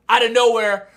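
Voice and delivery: ordinary talk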